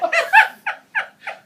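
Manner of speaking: high, loud burst of laughter. Real, not acted. Telling the bluefish story, bursting into loud laughter. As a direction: laugh hard at the memory. Laughing at his own refusal.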